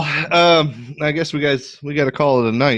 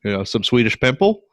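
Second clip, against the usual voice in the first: put-on Yooper accent. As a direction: chat like a Yooper fisherman. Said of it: "John's Yooper accent is better than mine".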